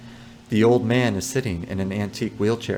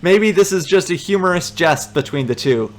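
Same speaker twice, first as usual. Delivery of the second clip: ordinary talk, nothing marked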